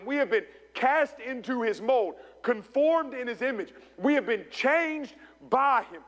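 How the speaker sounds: emphatic preaching. Real, not acted. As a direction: preach it with rising conviction. Preaches to congregation.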